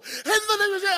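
high, strained preacher's cry; imitated prayer shout. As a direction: burst out like a fervent minister. Acting out minister praying over him.

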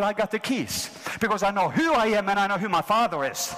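ordinary talk

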